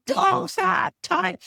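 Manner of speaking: slow, loud, high put-down; emphatic dismissal. Real, not acted. Therapist refusing to cater to prim clients, slow and loud. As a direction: draw it out with finality.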